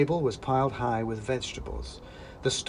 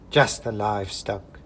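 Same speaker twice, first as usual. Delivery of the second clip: dismissive witch; 4 dB louder than usual. Acted. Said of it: "said the woman".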